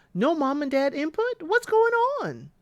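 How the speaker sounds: high, squeaked disbelief; high incredulous squeak. Real, not acted. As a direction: ask in baffled disbelief. Exasperated at Javin's family.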